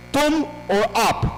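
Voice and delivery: ordinary talk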